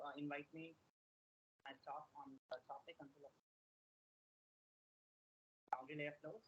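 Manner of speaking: faint and distant; too far from the mic; barely audible. Real, not acted. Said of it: "Your voice is very low".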